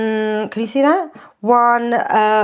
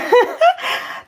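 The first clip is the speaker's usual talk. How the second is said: high giggle at her own fumble; embarrassed giggle; 13 semitones higher than usual. Real, not acted. Fumbling host laughs at herself on stream. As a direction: laugh off the mistake.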